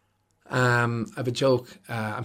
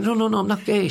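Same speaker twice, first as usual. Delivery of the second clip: high, insistent denial; protesting; 9 semitones higher, 3 dB louder than usual. Retold or imitated. Denying to his friend, high flustered protest. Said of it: "And I went".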